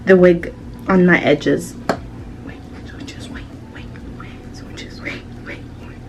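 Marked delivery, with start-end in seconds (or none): 2.4-6.0 breathy whisper, barely voiced. Real, not acted. Vlogger whispering to herself while the glue dries, whispered. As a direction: hush it to a whisper under the music.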